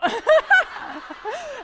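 a loud burst of real laughter, high; big laugh. Real, not acted. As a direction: burst out laughing at her own story. Punchline of the funny story.

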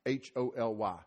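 spelling it letter by letter; each letter said on its own; spelled out. Shown as a demonstration. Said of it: "You're holy".

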